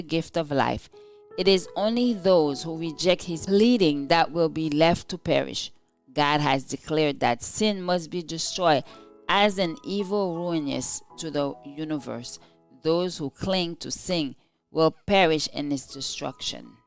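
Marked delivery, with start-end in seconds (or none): none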